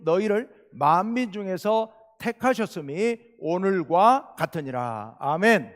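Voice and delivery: loud, slow, measured recitation; scripture reading aloud. Acted. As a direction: lead a congregation reading the verse in unison. Leads congregation reading scripture aloud.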